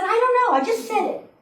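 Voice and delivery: baffled by your own words, half laughing; flustered admission. Retold or imitated. Butterworth confessing his odd word to the congregation, high and flustered. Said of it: "He said".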